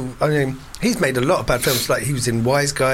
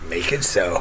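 mock captain command; 8 semitones lower than usual.

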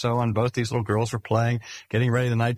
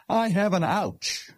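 lightly voiced small child, higher and slow; a hint of a whimpering toddler showing his hurt; little grandson's ouch; 8 semitones higher than usual. Preacher voicing his little grandson asking for comfort, lightly childlike.

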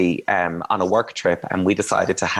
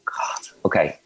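whisper; 3 semitones lower than usual. Retold or imitated. Sneaking drunk boyfriend in.

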